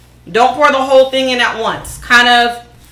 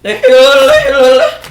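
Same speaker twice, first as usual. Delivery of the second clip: high laugh; 13 semitones higher, 6 dB louder than usual. Real, not acted. Laughing at own voice crack.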